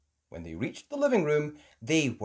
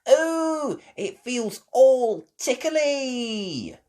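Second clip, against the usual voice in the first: giggly delight; 13 semitones higher, 8 dB louder, much slower than usual. Acted. Peppa's toes in sand.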